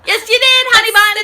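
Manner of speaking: a sugary clapback calling her honey bun; sassy retort. Acted. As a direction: talk back like a sassy friend.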